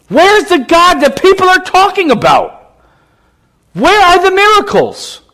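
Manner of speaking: impassioned preacher's climb; preaching at full force. Acted. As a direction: drive it home to the congregation.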